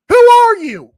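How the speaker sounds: demanding, outraged shout; angry shout. Real, not acted. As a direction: blast it out at them in outrage. Ranting at league officials.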